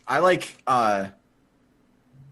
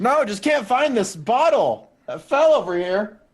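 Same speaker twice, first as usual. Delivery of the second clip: louder, higher call across; 6 semitones higher, 4 dB louder than usual. Acted. Edgar calling Heather across the garage, raised. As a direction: call her over with a pretext.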